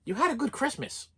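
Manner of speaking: mother brushing it off lightly; mother's dismissive reply. Retold or imitated. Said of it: "her response to that was".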